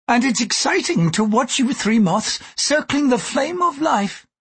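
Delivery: high-pitched, insistent character voice; emphatic Gyoko. Acted. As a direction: list your reasons emphatically, voice held high.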